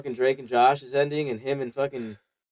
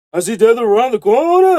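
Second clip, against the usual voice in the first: a touch of [name], higher and louder; mock [name]; 8 semitones higher, 7 dB louder than usual. Storyteller voicing [name] in a hallucination, lightly. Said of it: "[name]'s, like".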